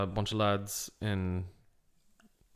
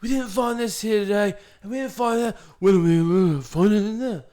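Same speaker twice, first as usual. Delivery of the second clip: hammed-up presenter, pitched way up; over-the-top mock dramatic impression; Tony Robinson; 10 semitones higher, 5 dB louder than usual. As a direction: wail it theatrically, high and swooping. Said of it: "Tony Robinson hams it up so much".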